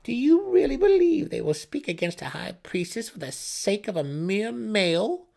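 high, loud, scornful sneer; mocking high priestess. Sister mocks her pinned brother with cruel scorn. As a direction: taunt him from above. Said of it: "Brisa laughed".

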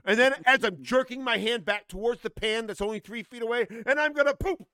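escalating furious retelling, voice climbing; building rant. Retold or imitated. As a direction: wind yourself up line by line like the loop is taking over. Ranting retelling of mushroom loop.

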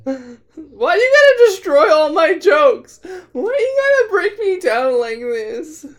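loud, high, drawn-out wail; hammed-up crying complaint; mock anguish. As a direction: wail in fake despair at a spoiled joke. Mock outrage at spoiled joke.

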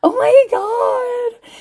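high, slow crying shout of disbelief; shocked cry. Retold or imitated. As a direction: blurt it out like the sight just hit you. Shocked reaction at the door.

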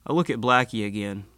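usual speaking voice

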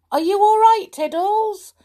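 high, loud concerned call. Acted. Tom calls up the chimney to frightened kitten, calling out. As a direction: call up to someone out of reach. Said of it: "called Tom".

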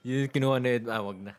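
usual speaking voice

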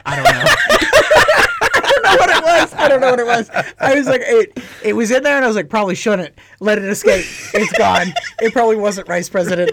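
high, loud, laughing through the words. Real, not acted. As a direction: crack up at your own slip. Laughing at own bad joke.

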